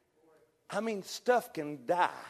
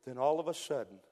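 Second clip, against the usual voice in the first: hushed storytelling. Acted. Tender retelling of Elijah story.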